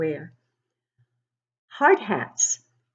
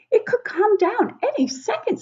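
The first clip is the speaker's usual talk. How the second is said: much higher, urgent warning. Acted. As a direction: warn the partner the ceiling will fall. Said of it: "says Dan".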